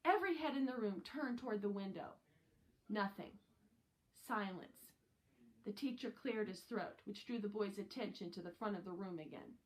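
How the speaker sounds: usual speaking voice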